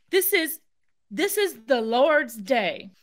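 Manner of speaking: slow, stunned repeat of the officer's words; incredulous repeat. Retold or imitated. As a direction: say it like you can't believe he said it. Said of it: "He has the gall".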